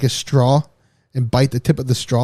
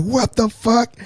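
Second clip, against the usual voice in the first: retold scream; 9 semitones higher, slower than usual. Storyteller imitating stepfather at the car, high mock scream. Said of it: "I see him screaming".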